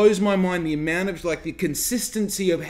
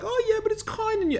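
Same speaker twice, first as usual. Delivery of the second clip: much higher, whiny mock voice; mock viewer objection. Vlogger imitating a viewer's objection, high mocking voice. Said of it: "you're probably gonna say, like".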